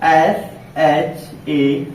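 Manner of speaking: each letter of S H A M E spaced out slowly; teacher dictating letters of a word one by one; slow letter-by-letter spelling. Shown as a demonstration. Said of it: "देखिए ऐसे".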